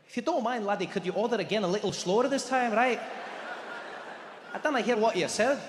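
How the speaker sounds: put-on polite Scottish-accented waiter; lilting Scottish waiter impression. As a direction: ask gently in a Scottish accent. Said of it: "I've got the Scottish Indian man".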